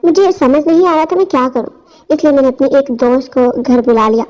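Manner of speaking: plain reading voice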